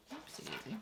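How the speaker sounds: very soft, under the breath; muttered little oops. Real, not acted. Crafter fumbling the rectangle ring, muttering to herself. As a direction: let it slip out quietly to yourself. Small slip while working.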